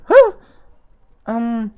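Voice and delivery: high, puzzled receptionist voice. Acted. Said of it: "and he's like".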